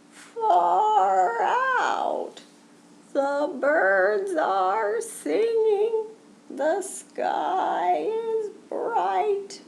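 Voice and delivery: high, slow, drawn-out turtle voice; happy turtle. Acted. Turtle admires the world, high and very slow.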